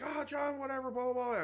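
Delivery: high-pitched gushing welcome; excited greeting. Retold or imitated. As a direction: squeal the hello like starstruck fans. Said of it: "they're like".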